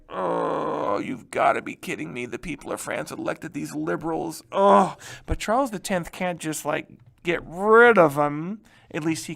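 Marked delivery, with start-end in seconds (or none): 0.1-5.0 mock exasperated king. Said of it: "Charles the Tenth is like".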